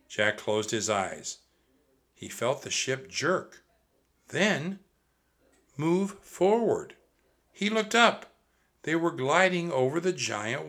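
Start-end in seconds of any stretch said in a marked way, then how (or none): none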